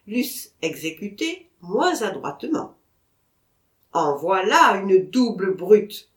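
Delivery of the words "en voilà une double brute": loud, slow, indignant exclamation; exasperated outburst. Acted. Vatrin scolding the pointer around the tree, loud and slow. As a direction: hammer out the insult at the dog. Vatrin fuming at the stubborn dog.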